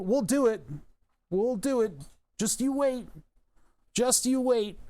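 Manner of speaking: mocking politician. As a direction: sneer at empty promises. Mocking politicians' empty promises.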